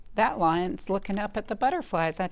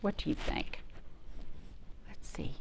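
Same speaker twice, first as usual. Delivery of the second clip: whisper; 10 dB quieter than usual. Real, not acted. Secret question to the children.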